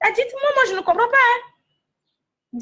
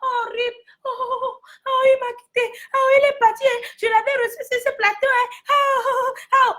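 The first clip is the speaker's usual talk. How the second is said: higher, wailing fake grief with oh oh; mock mourning host; 6 semitones higher than usual. A TV host mocking grief over a dead artist, wailing. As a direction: ham up a host's crocodile tears. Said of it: "quand l'artiste meurt là".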